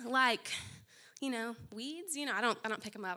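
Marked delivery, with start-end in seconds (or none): none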